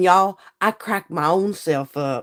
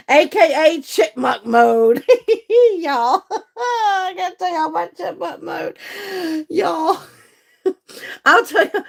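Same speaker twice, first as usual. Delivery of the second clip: high, giggling through the words; cracking up; 9 semitones higher than usual. Real, not acted. Telling viewers about chipmunk mode, cracking up. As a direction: talk through real bursts of laughter.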